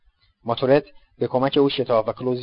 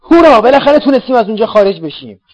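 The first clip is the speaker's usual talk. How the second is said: very high, loud cheer of triumph; joyful shout; 11 semitones higher, 12 dB louder than usual. Acted. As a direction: whoop with relief after the escape. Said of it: "از شدت خوشحالی ... فریاد کشید". (فریاد شادی بلند و زیر)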